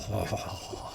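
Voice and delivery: quiet exhaled oh in character; soft acted "oh". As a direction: let out a hushed, breathy oh. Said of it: "as he's like".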